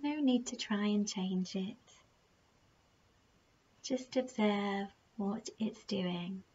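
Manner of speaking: slow, soft meditation voice with unhurried, drawn-out phrases; guided meditation. Acted. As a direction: gently guide the listener through their breathing, leaving long pauses.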